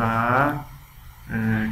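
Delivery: ordinary talk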